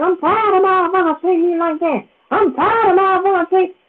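high mock complaint, whiny; imitating fed-up mother. Mom griping to daughter, high imitated whine. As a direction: play the exasperated mom griping.

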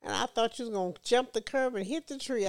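ordinary talk

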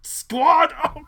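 high, loud hype shout; pumped-up bro cheer; frat bro. Retold or imitated. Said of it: "And it's like".

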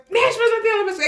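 snapping. Retold or imitated. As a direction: correct them sharply.

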